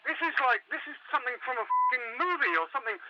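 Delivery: frantic, rambling, pitch jumping up; panicked caller. Acted. Caller trapped in the mall telling the host, panicked rant. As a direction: gabble it out, scared out of your wits. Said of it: "I can hear it in your voice".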